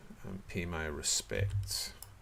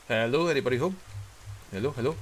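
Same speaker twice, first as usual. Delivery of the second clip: raised, higher calling voice; calling down a hallway; 6 semitones higher, 6 dB louder, faster than usual. Real, not acted. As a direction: call out loud to whoever is inside. Calling into an empty house.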